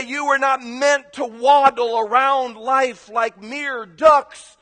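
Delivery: high, loud pulpit exhortation; fired-up mock preacher; duck preacher. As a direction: preach at the flock, stretching the ending. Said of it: "The duck preacher would go".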